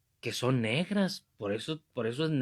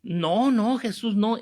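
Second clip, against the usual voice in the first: high, raised-voice protest; acted emphatic denial; 7 semitones higher, 4 dB louder, slower than usual. Man answering the priest's accusation, acted emphatic denial.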